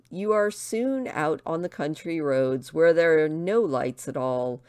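ordinary delivery, nothing marked

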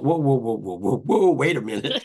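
loud, rising whoa-whoa-whoa of alarm; retelling his own alarmed pushback; startled protest. Retold or imitated. Startled protest at prison invitation.

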